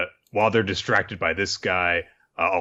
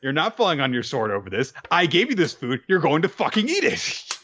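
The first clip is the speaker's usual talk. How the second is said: higher, firm mom voice; motherly scolding; 10 semitones higher than usual. Retold or imitated. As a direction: lay down the law like a mom. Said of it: "she's just like".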